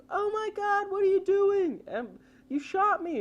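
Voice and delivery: high, shocked female voice; shocked girl. Retold or imitated. Shot girl crying out to the shooter, high and shocked. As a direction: squeal it like a terrified young woman. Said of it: "the girl's like".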